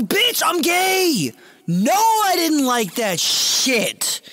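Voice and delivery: high, loud, stretched-out mock outrage; indignant yelling. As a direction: yell it slowly, thoroughly appalled. Rejects her question, outraged.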